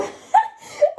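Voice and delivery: high squealing laugh; burst of laughter. Real, not acted. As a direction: crack up at yourself. Laughing at her own story.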